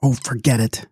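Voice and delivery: curt, irritated brush-off; snapped. A narrator's reading. Dancer snapping at her fortune-telling friend, curt. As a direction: snap it off, impatient. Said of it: "snapped Lavinia King".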